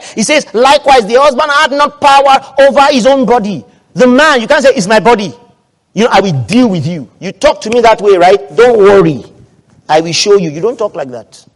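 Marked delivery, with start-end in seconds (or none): 0.0-5.3 loud, high-pitched sermon proclamation; forceful preaching. Real, not acted. Preacher declaring scripture to the congregation, forceful preaching. 5.9-10.5 acted threat. Voices a spouse threatening the other.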